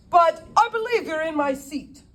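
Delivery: British gent politely cutting in. Acted. British lawyer politely interrupting, British accent. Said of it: "he spoke with a British accent".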